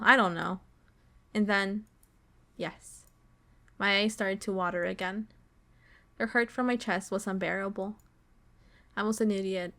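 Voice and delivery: ordinary delivery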